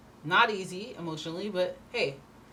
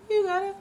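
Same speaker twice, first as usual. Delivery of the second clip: you gotta shot high and loud; high punchy you gotta; emphatic burst; 7 dB louder than usual. Real, not acted. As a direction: hit it hard the second time. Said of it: "emphatic repeat".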